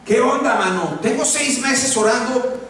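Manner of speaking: high, slowed, whining mock prayer; whiny complaining prayer. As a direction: pray like a spoiled complainer. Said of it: "como si yo orara y dijera". (oración quejumbrosa, aguda y burlona)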